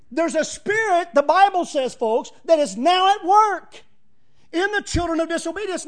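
fervent preacher building heat; preaching at full force. Real, not acted. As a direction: drive it home from the pulpit.

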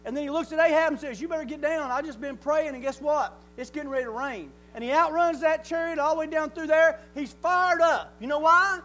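loud, rising preacher's call; preaching at full force. Real, not acted. Preacher urging his church with full-force preaching. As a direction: drive it home to the congregation. Preaching to congregation about Elijah.

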